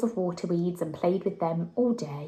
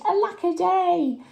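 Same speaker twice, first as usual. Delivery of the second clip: cried; 11 semitones higher, 3 dB louder than usual. A narrator's reading. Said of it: "and he cried".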